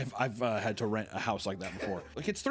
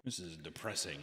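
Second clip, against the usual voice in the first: glum mutter; 6 semitones lower, 10 dB quieter than usual. Retold or imitated. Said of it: "and I was like".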